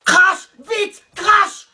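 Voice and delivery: loud, high rapid-fire commands; urgent barked order. Acted. Teacher demanding the gum now, high shouted orders. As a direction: press the pupil to obey at once.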